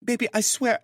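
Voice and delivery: high female voice begging; high-pitched woman pleading. Acted. Grace pleading in bed.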